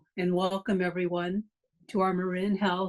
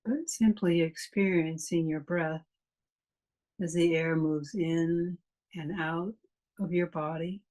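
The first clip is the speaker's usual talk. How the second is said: calm meditation voice with long pauses; guided meditation. Real, not acted. Meditation teacher leading a class through a body scan, calm guided meditation. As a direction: guide them unhurriedly, leaving long silences between phrases.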